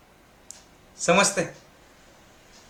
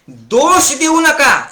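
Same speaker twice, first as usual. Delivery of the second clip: slow, high and loud; loud, slow preaching command; emphatic command; 9 semitones higher, 5 dB louder, much slower than usual. Acted. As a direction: hammer home each word.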